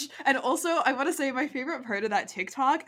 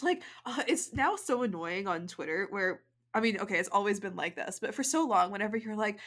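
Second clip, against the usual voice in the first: ordinary talk, nothing marked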